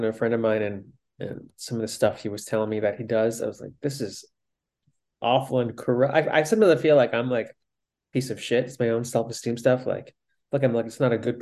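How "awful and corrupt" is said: ordinary talk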